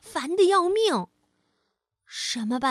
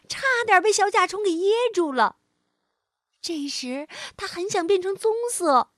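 usual speaking voice

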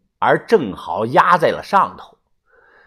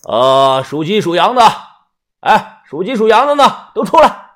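louder, rising call to a group; 6 semitones higher, 5 dB louder than usual. A narrator's reading. Mr. Song summons helpers to dig the grave, calling out. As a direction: shout the summons out to the workers. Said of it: "又喊道".

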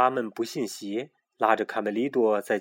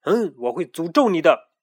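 louder, higher stern curse; stern threat; 5 semitones higher, 7 dB louder than usual. A narrator's reading. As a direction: threaten him severely. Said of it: "语气严厉地说". (语气严厉地诅咒)

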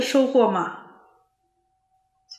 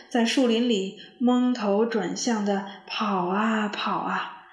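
plain reading voice

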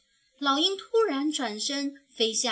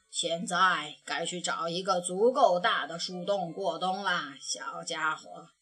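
put-on gentle old grandpa; 6 semitones lower than usual. A narrator's reading. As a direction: ease the cub toward sleep. Grandpa bear speaks. (熊爷爷轻声叫小熊去过冬，低沉缓慢)

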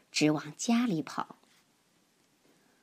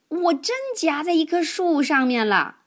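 high, loud, insisting cry from the tree; stuck little cousin; 9 semitones higher, 6 dB louder than usual. Acted. Webb stuck in a tree insists to Elmer, high plaintive cry. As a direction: plead that it really is true.

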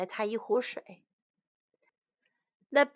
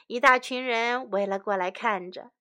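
usual speaking voice

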